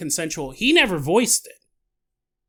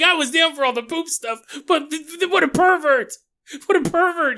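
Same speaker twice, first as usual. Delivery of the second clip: mock outraged; 9 semitones higher, 4 dB louder than usual. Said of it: "You're like".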